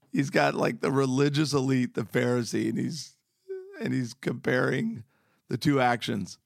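ordinary talk

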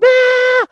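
sudden very high, loud scream of terror; imitating a terrified scream jolting awake from sleep. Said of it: "Literally, I'd wake up going".

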